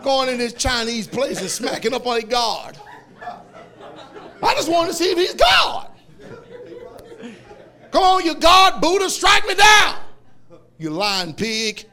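high, loud, laughing shout; hammed-up alarmed friend; Carol's loud protest. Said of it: "He said".

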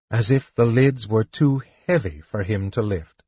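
ordinary delivery, nothing marked

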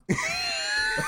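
squealing laugh; high shrieking laugh. Real, not acted. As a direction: burst out in a shrill laugh. Laughing after "it's dark.

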